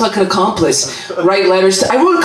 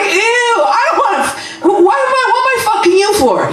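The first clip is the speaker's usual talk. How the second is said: disgusted shout; 10 semitones higher, faster than usual. Retold or imitated. Comic talking to himself in the mirror, shrieking in mock disgust. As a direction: shriek it out in mock horror, voice cracking. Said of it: "I'm like".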